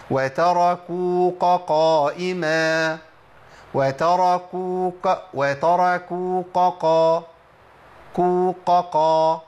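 slow loud chant, kaf thickened into qaf in وتركوك قائما; drawn-out faulty recitation, وتركوك ending in q before قائما; kaf turned to qaf. Shown as a demonstration. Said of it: "إبدال الكاف بقاف".

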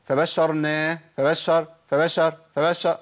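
فبشرناه with a single short sh, not doubled; lightened shin. Shown as a demonstration. Teacher showing what won't do, lightened shin in فبشرناه. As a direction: skim lightly over the sh of فبشرناه. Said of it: "ولا أخفف الشين".

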